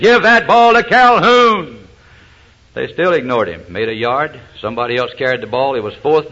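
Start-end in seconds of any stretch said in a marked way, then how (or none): 0.0-1.9 very high, loud, drawn-out yell; acted-out coach hollering slowly at his quarterback; more upset coach. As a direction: stretch each word in an exasperated holler. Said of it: "he got a little more upset".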